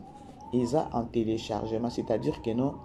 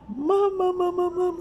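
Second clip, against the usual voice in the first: high excited squeal; 3 dB louder than usual. Real, not acted. Excited outburst on plane.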